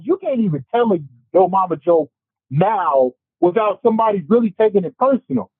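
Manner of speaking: ordinary talk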